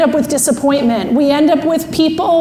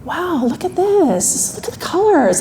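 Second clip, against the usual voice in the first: slow, dreamy marvelling, pitched up; drawn-out, softly awed; slow-moving wonder; 3 semitones higher, 3 dB quieter than usual. Retold or imitated. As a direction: imitate someone amazed by every little thing. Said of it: "one of the slowest people I've ever met".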